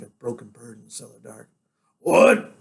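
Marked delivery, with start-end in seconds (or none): 2.0-2.5 loud, raised, startled question; sharp demand. Acted. Father reaching for son who accused him, loud startled demand. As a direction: fire the question out.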